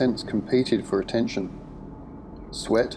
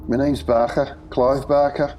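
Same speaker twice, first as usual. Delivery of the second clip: put-on Scouse accent; 5 semitones higher, 3 dB louder than usual. Barker introduces himself.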